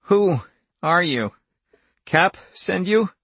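high, slow, strained questions; frightened victim gasping out questions; hoarse gasping. A narrator's reading. Wanless gasping at the intruder over his bed, hoarse gasping. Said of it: "began to gasp hoarsely".

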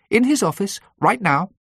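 high, urgent summons; urgent secretary. A narrator's reading.

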